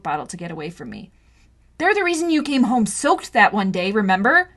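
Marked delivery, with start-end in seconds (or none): 1.8-4.5 higher, loud accusing; heated reminder; angry. Acted. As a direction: press the point hard. Arguing with brother.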